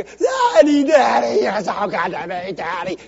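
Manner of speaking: high, loud gushing cry to Daddy; going gaga. Pretend. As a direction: gush over Daddy like a thrilled child. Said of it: "Go gaga to your".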